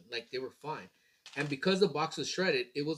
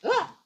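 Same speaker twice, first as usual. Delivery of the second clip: sudden high yelp of surprise; surprised yelp; 7 dB louder than usual. Real, not acted. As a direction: yelp out, caught off guard. Reacts to the box.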